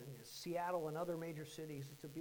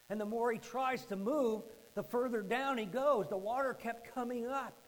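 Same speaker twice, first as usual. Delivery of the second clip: raised, louder, mounting urgency; urgent storytelling; 5 semitones higher, 7 dB louder than usual. Retold or imitated. Preacher telling of a boy sinking, urgent and loud. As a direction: drive the danger home.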